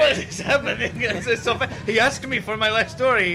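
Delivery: put-on exasperated Spanish-accented swordsman. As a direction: protest in a Spanish accent, bewildered. Guillermo complains in Spanish accent.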